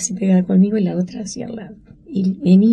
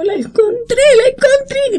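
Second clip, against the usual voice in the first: high, crying exclamation of joy; tearful joyful cry. Retold or imitated. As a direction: shout the good news while close to tears. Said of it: "gritaba yo". (grito agudo de alegría entre lágrimas)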